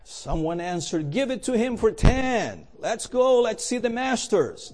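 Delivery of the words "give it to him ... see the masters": raised, higher impatient heckle; impatient call. Acted. Annoyed bidder urges the auctioneer on, raised impatient call. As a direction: hurry the auctioneer along.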